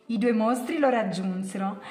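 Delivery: ordinary delivery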